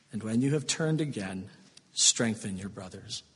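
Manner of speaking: Jesus gently comforting Peter, soft and tender. Acted. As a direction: speak to Peter softly like a worried friend. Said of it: "Moment of tenderness".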